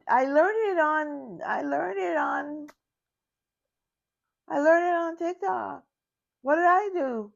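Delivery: high, whiny what did I do; confused grandma. Retold or imitated. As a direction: plead innocence like a baffled grandma. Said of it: "I was like".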